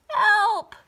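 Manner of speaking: loud high scream of "Help!"; cry for help. A narrator's reading. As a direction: call out desperately. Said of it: "cried the witch".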